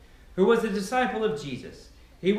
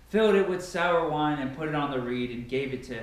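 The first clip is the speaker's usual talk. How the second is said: plain reading voice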